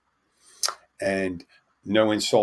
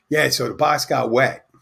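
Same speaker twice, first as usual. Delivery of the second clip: louder, higher imitation of an eager friend; eager storyteller; 5 semitones higher, 6 dB louder than usual. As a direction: press on with the tale, voice raised. Acted friend pressing on with story.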